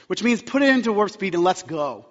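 ordinary delivery

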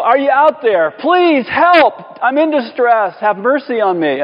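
high, urgent cry for help; emotional pleading voice. Acted. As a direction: plead loudly for mercy, easing off at the end. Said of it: "it's that kind of voice".

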